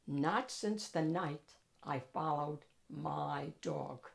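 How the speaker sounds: ordinary delivery, nothing marked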